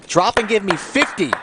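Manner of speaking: loud, high drill sergeant bark. Pretend. Commentator barks a drill command.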